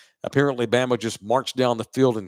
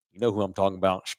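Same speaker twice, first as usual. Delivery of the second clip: usual speaking voice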